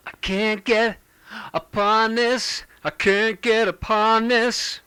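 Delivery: bright, sung, distorted take; treble-heavy distorted vocal; aggressive treble distortion. Shown as a demonstration. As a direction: sing it with treble up. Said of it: "that's much more aggressive".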